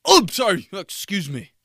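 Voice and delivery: high, loud fake sorry; mock startled apology. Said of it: "back up right when I open".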